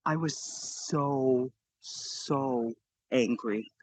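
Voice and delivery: low, slow, quiet furious words; low seething anger. Retold or imitated. Recalling being revived, low slow seething anger. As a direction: drag out the fury low and quiet.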